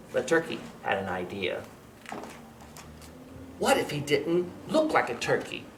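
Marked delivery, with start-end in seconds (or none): none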